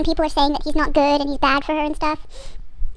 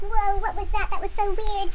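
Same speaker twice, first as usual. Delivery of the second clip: higher-pitched amazed reaction; mock astonishment; 6 semitones higher than usual. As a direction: imitate a bewildered onlooker. Crowd reacts to magic explosion.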